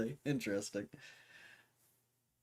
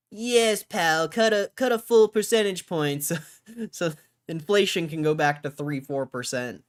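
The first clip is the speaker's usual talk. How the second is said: mocking voice; 8 semitones higher, 10 dB louder than usual. Mocking Trump's rate demand.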